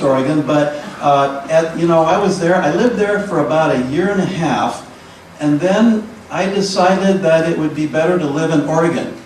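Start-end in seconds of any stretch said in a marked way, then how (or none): none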